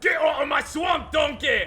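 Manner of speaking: put-on Scottish accent, ogre shouting at donkey; shouted Scottish Shrek. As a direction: roar it like an ogre in a recording booth. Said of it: "And then Michael Myers just".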